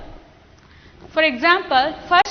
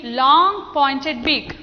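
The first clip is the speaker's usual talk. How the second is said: normal talking voice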